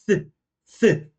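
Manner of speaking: isolated "f" sound for German V. Shown as a demonstration. Teacher isolating the V sound, a bare "f". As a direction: blow a lone "f", no vowel. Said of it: "o V a gente fala com som de F".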